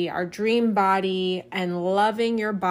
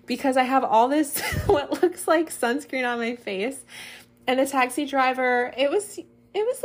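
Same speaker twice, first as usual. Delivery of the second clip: higher, talking through a laugh; amused laughing; 6 semitones higher than usual. Real, not acted. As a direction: laugh at yourself as you tell it. Laughs recounting own odd look.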